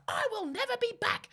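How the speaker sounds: high, loud mock indignation; hammed-up parting outburst; outraged customer. Refused coffee customer storming out, imitated as shrieky outrage.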